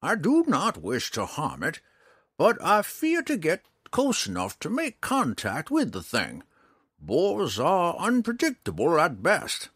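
high, emphatic ranger voice; old ranger. A narrator's reading. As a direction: warn him as the old ranger. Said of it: "the old ranger explained".

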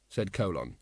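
usual speaking voice

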